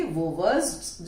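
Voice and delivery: usual speaking voice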